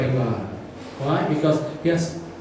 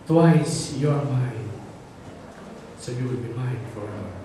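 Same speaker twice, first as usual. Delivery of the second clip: solemn tender claim; 4 semitones lower, 6 dB quieter than usual. Acted. God declaring to the listener they are His, tenderly. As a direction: let it settle softly and deeper. Preacher gives it as God's declaration.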